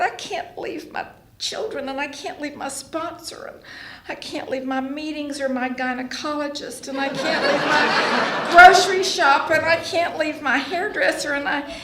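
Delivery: high whining list, building to a wail; whiny younger self. Retold or imitated. Retold whining list of excuses.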